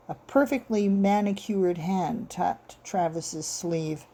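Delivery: usual speaking voice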